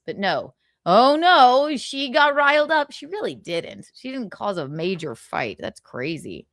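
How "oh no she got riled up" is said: high, loud mock horror; mock alarm. Mocking those who say she got riled up, mock alarm. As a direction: exaggerate the shock to ridicule it. Mocks alarm over actress.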